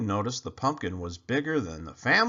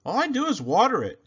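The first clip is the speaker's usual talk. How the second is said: put-on higher, fast and bright boyish shrug; boy's innocent shrug; 9 semitones higher, 6 dB louder, faster than usual. Clayton shrugs off his mother's praise, high and quick. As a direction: toss it off like a kid hiding a secret.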